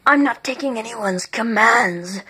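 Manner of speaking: plain reading voice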